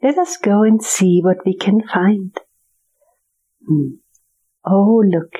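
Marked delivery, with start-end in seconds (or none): none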